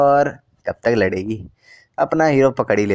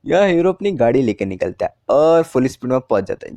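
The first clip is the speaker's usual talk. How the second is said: usual speaking voice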